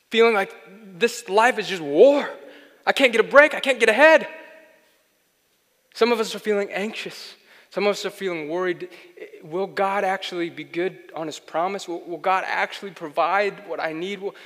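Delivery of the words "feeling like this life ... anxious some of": loud, high, driving sermon delivery; loud, rising preacher's cadence; full-force preaching. Acted. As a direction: preach it hard to a weary congregation. Preaching the struggle.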